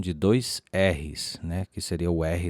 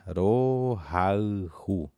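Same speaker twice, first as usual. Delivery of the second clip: "Rohaihu" drawn out very slowly, syllable by syllable; very slow and spaced; much slower than usual. Shown as a demonstration. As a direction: spell it out for the class. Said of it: "vou falar novamente a pronúncia bem pausada".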